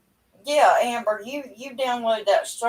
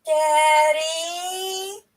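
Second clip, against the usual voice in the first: put-on higher, drawn-out little girl calling Daddy; imitating granddaughter; 7 semitones higher than usual. Grandma voicing toddler on the phone, high drawn-out call. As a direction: stretch it like a small child wanting Dad. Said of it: "first thing out of her mouth".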